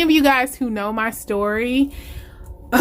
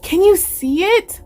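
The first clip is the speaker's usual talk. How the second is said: high, eager question with a lift; excited voice; 9 semitones higher, 4 dB louder than usual. Real, not acted. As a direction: ask it pitched up, bursting with delight. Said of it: "with my exciting voice".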